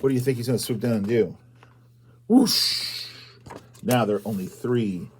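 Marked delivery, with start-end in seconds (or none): 2.3-3.1 high breathy swoosh of the owl; breathy whoosh. Acted. The owl swoops for a pumpkin, airy whoosh. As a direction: blow it like wings rushing.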